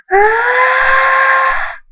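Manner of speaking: very high, loud held scream; long scream. A narrator's reading. Thunderstorm keeps screaming as friends call him, long scream. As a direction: scream without letting up.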